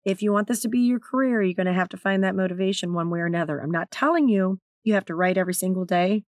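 usual speaking voice

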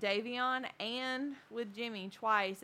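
ordinary talk, nothing marked

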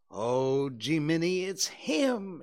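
loud outburst climbing high in pitch; alarmed exclamation. Acted. Both boys spot whom the dog is facing, alarmed exclamation. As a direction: blurt it out in one rising breath. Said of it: "exclaimed both boys in a breath".

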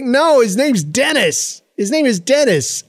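high, drawn-out protest; exasperated correction. Acted. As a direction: correct him in an exaggerated, high-pitched voice. Acted correction of son's mishearing.

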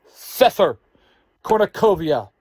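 high, loud summons; commanding yell. A narrator's reading. Dark king summons his two generals, commanding yell. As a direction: yell the names out to summon them. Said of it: "he yells".

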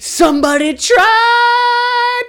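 very high, slow, loud mock wail; mock panicked Lex. Lex telling the doctor about the attack, high slow mock wail. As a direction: wail it out like a cartoon.

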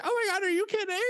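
very high mock squeal; imitating a high excited shriek; squealing disbelief. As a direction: squeal it like a giddy friend. Imitating her reaction, very high.

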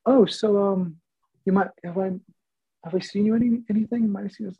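high, gushing excitement; star-struck nurse. Retold or imitated. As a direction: nurse realising she's met a celebrity, high and gushing.

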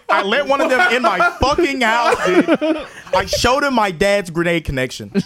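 hammed-up indignant kid, pitch climbing; mock outrage. Robbed kid complaining to Brandon about the stolen grenade, mock outrage.

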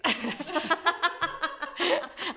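high, loud burst of laughter; big high laugh. Real, not acted. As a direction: laugh out loud at her own joke. Joke about alcohol to babies.

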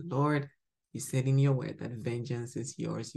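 usual speaking voice

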